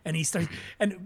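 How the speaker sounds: usual speaking voice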